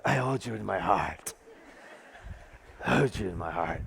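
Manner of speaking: very low, soft, lingering sweet talk; hammed-up bedroom voice, take your time; mock seductive. Shown as a demonstration. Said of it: "you gotta say it with some time".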